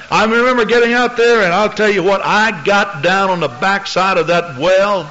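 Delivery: preaching. Real, not acted. Preaching at full force.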